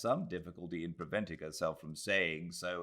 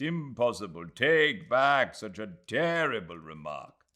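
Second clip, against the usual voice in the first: louder, playful indignant man; mock protest; 4 semitones higher, 5 dB louder than usual. Aziz protesting to Adela, playfully indignant. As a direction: protest with a raised hand.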